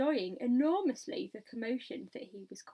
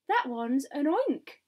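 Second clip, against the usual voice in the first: loud, higher, drawn-out cry; alarmed scream; 4 semitones higher, 8 dB louder, slower than usual. A narrator's reading. Fire chief screaming at the grasshopper, alarmed cry. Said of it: "screamed the head of fi".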